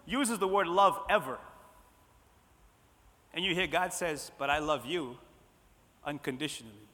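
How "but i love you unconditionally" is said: low, hushed voice of God; gentle reassurance. Acted. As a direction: drop low and soft, pausing before the last word. God's answer to the unloved.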